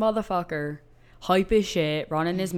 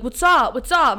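mock challenge; 5 semitones higher, 5 dB louder than usual. Friend squaring up.

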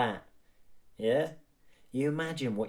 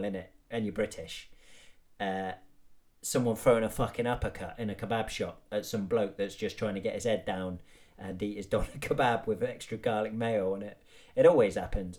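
ordinary delivery

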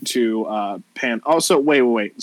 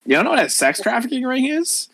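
put-on mean-girl voice, pitched up then dropping; condescending Valley girl; 11 semitones higher than usual. Valley girl explaining to a clueless newcomer, condescending. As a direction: talk down to her as a Valley girl.

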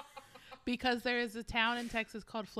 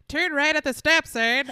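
loud, raised navigation-assistant impression; mock sat-nav call-out; Siri voice; 5 semitones higher, 11 dB louder than usual. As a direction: announce directions like a GPS. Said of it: "You should be a Siri voice".

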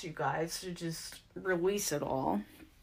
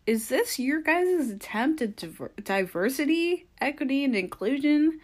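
sarcastic disbelief; 8 semitones higher, 4 dB louder than usual. Retold or imitated. Mocking question about host choice.

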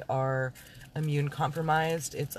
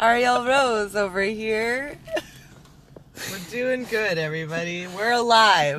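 excited announcing; 6 semitones higher, 10 dB louder than usual. Acted. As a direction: call it out big like a show host. Energetic host intro.

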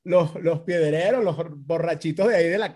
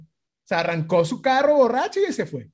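ordinary talk, nothing marked

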